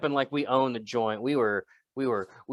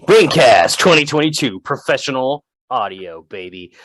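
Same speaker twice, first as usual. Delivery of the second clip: loud, hammy segment ident; announcer shout; 9 dB louder than usual. Pretend. Host proudly echoes his segment jingle, loud announcer voice. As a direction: belt out the show's jingle line, then ease off.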